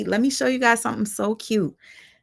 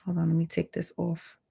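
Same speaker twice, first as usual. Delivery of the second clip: usual speaking voice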